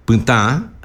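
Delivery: "puta" as pytã, final a through the nose; "puta" with a nasalised last vowel; nasal final a. Shown as a demonstration. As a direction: let the stressed a of "puta" resonate in the nose. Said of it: "nasal la A".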